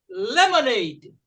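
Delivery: "Lemonade" slow and loud, each syllable clear; slow model word. Shown as a demonstration. Teacher drills the class, slow careful "Lemonade". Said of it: "Repeat, please".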